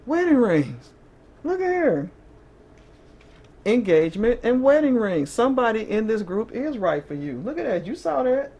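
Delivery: high and loud, thrilled by the card; excited. Real, not acted. Reader thrilled to reveal wedding rings card to viewers, excited high exclamation. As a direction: burst out at the good card.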